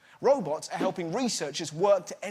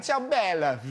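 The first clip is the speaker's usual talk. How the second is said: mock Italian.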